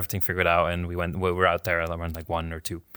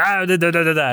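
high, louder gibberish mimicking angry shouting; mock foreign gibberish; 8 semitones higher, 5 dB louder than usual. Imitating the shouting cleaners for the friend, high mock gibberish. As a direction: parrot the shouting you couldn't understand.

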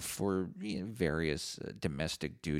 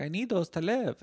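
much higher, louder, upset mother voice; imitating mom; 13 semitones higher, 5 dB louder than usual. [name] imitating his mom finding pills missing, high pleading voice. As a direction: play the mother catching her son. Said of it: "she's like".

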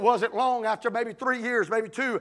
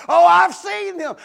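higher, louder, slow sneer; mocking scoff; 7 semitones higher, 10 dB louder, slower than usual. Doubters scoffing at a convert, loud and slow. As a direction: throw it out with contempt.

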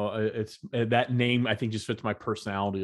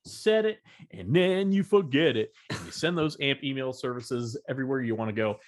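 ad read; 5 semitones higher than usual. Acted. Ad read for sponsor.

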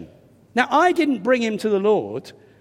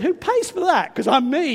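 much higher, cheeky demand; blunt nosy question; 7 semitones higher than usual. Retold or imitated. Preacher caricaturing his blunt question to the stranger, higher and cheeky. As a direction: poke at him without tact. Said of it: "I didn't say it quite like that".

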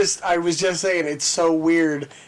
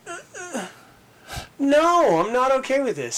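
mock whimpering; 7 semitones higher than usual. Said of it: "And I was all".

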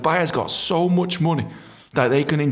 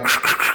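imitated walkie-talkie static; throaty mouth-made static; radio crackle. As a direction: crackle like a radio between messages. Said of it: "I could just hear the radio".

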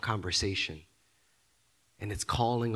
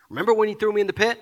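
higher, louder, very fast; mock accusing outburst; 8 semitones higher, 8 dB louder, much faster than usual. Brother blurts out an old grievance in a fast loud rush. Brother voiced in a hard talk.

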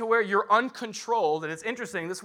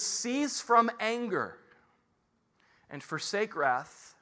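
scripture reading; 4 semitones higher, slower than usual. Acted. Reads scripture slowly.

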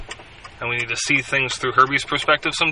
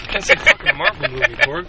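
scolding superior; 11 semitones higher than usual. Acted. Scolding Korg in character.

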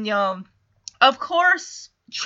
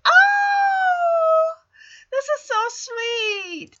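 a much higher, slow gushing coo; gushing aww; 14 semitones higher, much slower than usual. Retold or imitated. Viewer gushing at Punky hugging Robert, slow high coo. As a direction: coo over it like it's adorable. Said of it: "It's like".

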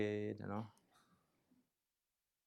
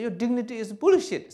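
mock critic; 10 semitones higher, 8 dB louder than usual. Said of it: "Somebody say".

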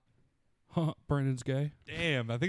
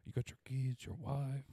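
imitated movie gangster; 11 dB quieter than usual. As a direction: mimic his quiet menace, much softer. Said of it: "He did say it like that".

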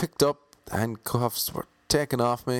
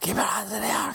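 much higher police command; mock armed cop yell; 12 semitones higher than usual. Two police officers with guns drawn barking at him, higher mock yell. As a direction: yell the order down a gun barrel, hammed up.

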